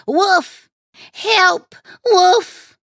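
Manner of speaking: high, loud alarm cries; shouting for help. A narrator's reading. Shepherd boy raising a false alarm to the village, shouting. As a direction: yell each word at the top of your voice. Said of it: "He started shouting at the top of his voice".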